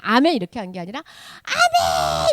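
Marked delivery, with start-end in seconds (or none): 1.4-2.3 high, drawn-out amen; fervent amen. Shown as a demonstration. Teacher showing how the people answered, fervent amen. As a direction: cry amen with all your heart. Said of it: "이렇게 한 거예요".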